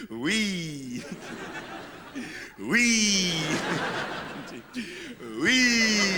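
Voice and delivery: squeaky little "wee" calls; mock child glee. Dad imitating daughter's glee on the slide. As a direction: mimic a toddler sliding.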